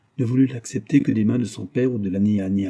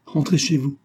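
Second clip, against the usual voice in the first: firm order, pitched up; 5 semitones higher than usual. A narrator's reading. Husband sending his wife away, firm. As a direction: order it firmly. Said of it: "dit-il d'un ton ferme".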